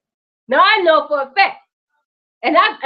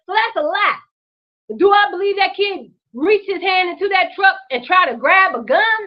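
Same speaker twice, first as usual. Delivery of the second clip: ordinary talk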